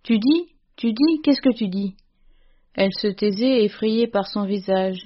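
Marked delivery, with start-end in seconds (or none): 0.0-1.9 higher, halting questions dropping at the end; stunned disbelief with strong emotion underneath; faint, trembling stammer. A narrator's reading. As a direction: narrator voicing a shocked father, pitched up. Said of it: "il balbutia d'une voix éteinte, tremblante".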